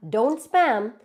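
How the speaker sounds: ordinary talk, nothing marked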